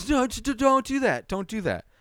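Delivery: high, stammering, panicked cry; shrill-pitched panic at the screen; freaking out. Retold or imitated. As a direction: beg the kid to stop, losing it. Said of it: "I'm freaking out".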